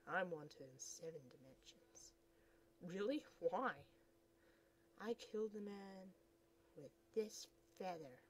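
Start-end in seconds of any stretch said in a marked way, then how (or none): none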